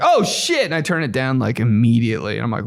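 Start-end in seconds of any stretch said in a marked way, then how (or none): 0.0-0.7 high-pitched startled yelp; mock panicked exclamation. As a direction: blurt it like caught with volume up.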